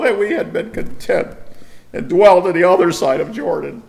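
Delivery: high, wailing, whiny lament; pathetic Joshua impression. Preacher mocking Joshua's lament to the Lord, high and whiny. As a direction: ham up a despairing Joshua, crying to the Lord. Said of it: "Joshua impression is pathetic".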